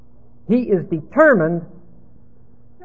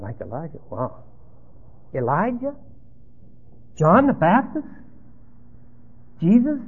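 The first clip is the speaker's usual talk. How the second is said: incredulous announcer; 2 semitones higher, 6 dB quieter than usual. Acted. Announcer mocking the crowd's view of Jesus, incredulous. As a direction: scoff at the answers, growing incredulous. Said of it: "news announcer goes".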